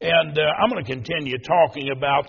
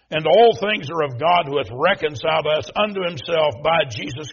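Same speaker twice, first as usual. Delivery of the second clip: loud, higher, proclaiming the verse; scripture reading; 3 semitones higher, 5 dB louder than usual. Acted. Preacher reading Second Corinthians to the standing congregation, proclaiming. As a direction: read the Bible aloud like a proclamation. Reads scripture at full force.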